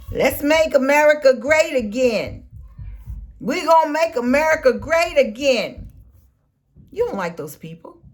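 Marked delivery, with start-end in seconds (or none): 0.1-5.9 louder, higher mock rally chant; mocking the slogan. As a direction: ham up the supporters' slogan. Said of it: "talking about".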